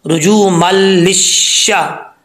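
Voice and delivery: रुजू मल लिशा slow, with a doubled lam; slow recitation. Shown as a demonstration. Teacher joining the syllables for students, slow. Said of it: "लाम शीन दे लिश".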